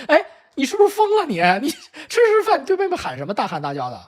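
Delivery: high-pitched retold telling-off; parent scolding. Parents scold the eldest for yelling at sister, indignant. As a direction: act out an incredulous parent rebuking a child. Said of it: "这个时候跟爸爸妈妈说的".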